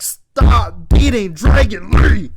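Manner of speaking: shouted plea. Retold or imitated. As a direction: yell at the screen. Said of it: "I was like".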